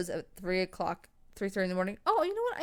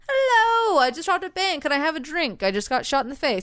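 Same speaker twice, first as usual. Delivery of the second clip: cheery bank robber; 6 semitones higher, 4 dB louder than usual. Retold or imitated. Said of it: "he was just like".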